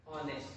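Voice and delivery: "ऑनेस्ट" vowel-first; pronounce honest. Shown as a demonstration. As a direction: model "ऑनेस्ट" with no h sound. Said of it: "ऑनेस्ट कौन कैसे पढ़ते हैं?".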